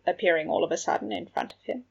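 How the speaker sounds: ordinary delivery, nothing marked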